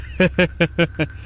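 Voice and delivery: high, choppy giggling; leering cackle. Acted. Rowdy laughing after ogling a girl, leering cackle.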